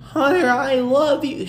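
high, slow mocking wifey voice; weird Stephanie voice. Host mocking a wrestler's wife in a high silly voice. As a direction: coo it in a silly high put-on voice. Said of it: "She has, like, this weird, uh, voice to her".

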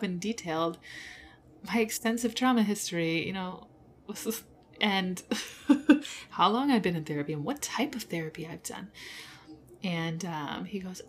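ordinary delivery

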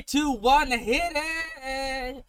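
hyped countdown into the show; campy drag queen. Acted. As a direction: call the cue like a diva. Podcast intro countdown.

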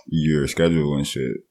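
usual speaking voice

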